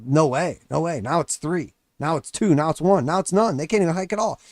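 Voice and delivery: usual speaking voice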